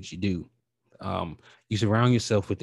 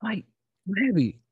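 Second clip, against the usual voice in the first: really happy; 4 semitones higher than usual. Real, not acted. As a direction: burst out with real happiness, climbing higher. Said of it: "I don't know if you can hear it".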